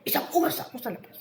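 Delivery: high, quick mock-indignant protest; retold angry complaint. Co-worker left outside an hour scolds housemate, angrily retold. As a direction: imitate the fuming co-worker, rushing it out. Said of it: "galit na galit siya".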